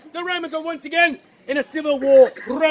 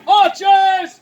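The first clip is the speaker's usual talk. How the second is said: loud commanding shout, higher pitched; crying out; 4 semitones higher, 8 dB louder than usual. Acted. Antony calling to the Roman archers, battle shout. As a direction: call out to troops across a field. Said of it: "Antony cries out to the Roman archers".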